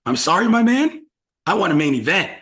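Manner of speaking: high, loud mock apology; apologetic Damian. Damian apologizing to Finn for throwing him in, loud mock apology. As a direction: say sorry loudly, a little sheepish. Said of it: "He's like".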